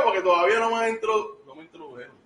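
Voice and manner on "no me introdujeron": whispered aside. Real, not acted. Complains he wasn't introduced.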